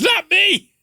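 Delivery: high squeaky protest; mock high bunny voice; big bunny denying. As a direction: squeal the denial like a guilty cartoon bunny. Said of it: "He goes".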